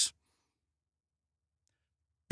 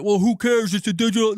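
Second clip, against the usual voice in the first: mock dismissive gamer voice, a bit louder; mocking imitation; 4 dB louder than usual. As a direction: sneer out the lazy excuse. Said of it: "none of this bullshit".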